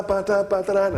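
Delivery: high, bouncy scatted carnival beat; mock carnival music. Imitating Trinidad carnival trucks, high bouncy scat.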